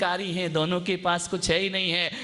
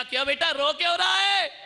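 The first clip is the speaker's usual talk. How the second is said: calling out; 10 semitones higher, 3 dB louder than usual. Retold or imitated. Calling to crying child.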